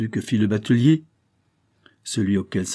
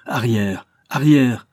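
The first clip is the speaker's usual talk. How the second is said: rising to a louder, harsh command; stern rebuke; 2 semitones higher, 5 dB louder, slower than usual. A narrator's reading. Archbishop driving the kneeling boatman away, a louder stern rebuke. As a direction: drive him back with a sharp order.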